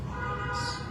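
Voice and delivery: breathy whisper, no voice. Real, not acted. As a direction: whisper it to the crowd. Sermon echo of a point.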